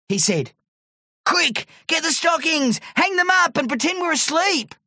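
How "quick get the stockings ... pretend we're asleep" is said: high-pitched, urgent excitement; excited urging. A narrator's reading. Terry expects Santa and urges his friend, excited. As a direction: hurry a friend along excitedly.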